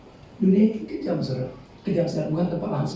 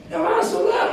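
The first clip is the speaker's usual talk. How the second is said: high-pitched put-on invitation; mock welcome; slower than usual. Person letting the devil in, mock high welcome. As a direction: usher them in with a high voice.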